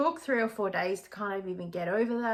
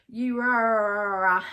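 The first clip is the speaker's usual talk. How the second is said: louder dismissive blah-blah of her rant; mock ranting; 7 dB louder than usual. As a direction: wave off her nagging. Mocking the woman's rant.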